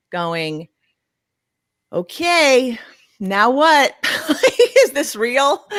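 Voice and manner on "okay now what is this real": higher, halting disbelief with a laugh; bewildered new parent. Retold or imitated. New mom with baby home from hospital, bewildered. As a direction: pause between questions, amused and at a loss.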